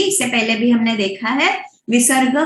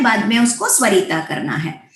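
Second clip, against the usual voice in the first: ordinary talk, nothing marked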